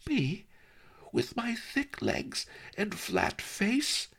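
put-on girl's high, outraged disbelief; indignant exclaim. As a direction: she can't believe she's meant. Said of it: "Lotus Cloud exclaimed".

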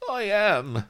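high whimper. A narrator's reading. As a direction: whine it pitifully. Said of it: "whimpered Vladimir".

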